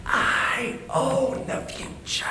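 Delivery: high, slow whispered triumph; whispered glee. Retold or imitated. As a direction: gloat silently in a high whisper.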